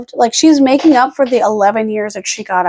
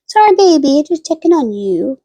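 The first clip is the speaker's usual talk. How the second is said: high, gentle sorry to the dog; 5 semitones higher than usual. Real, not acted. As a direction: soothe a sleepy pet. Apologising to the sleeping dog.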